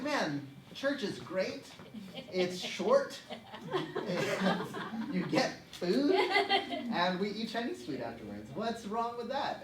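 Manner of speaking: playful enthusiasm. Retold or imitated. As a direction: draw out each point with comic relish. Acted childhood verdict on church.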